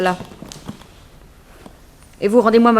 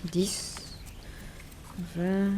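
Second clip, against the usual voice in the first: counting the bills half under the breath, much softer; quiet counting; 4 semitones lower, 18 dB quieter than usual. Acted. Woman counting her pay under her breath, very soft. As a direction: murmur the numbers to yourself as you count.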